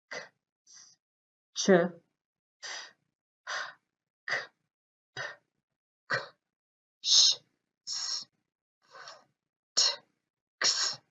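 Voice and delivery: "K, s, ch, f" said crisply, no voice, one by one; voiceless sounds drill. Shown as a demonstration. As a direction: sounds "k", "p", "sh", "t" spoken without vibration in the throat. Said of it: "repeat them after me".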